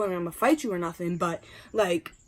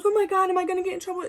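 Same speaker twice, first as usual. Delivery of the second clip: scared friend; 11 semitones higher, 4 dB louder, faster than usual. Retold or imitated. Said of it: "She's so scared".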